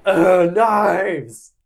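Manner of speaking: playful effortful shout; mock battle cry. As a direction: burst out as if hurling blades. Acting out her knife throwing.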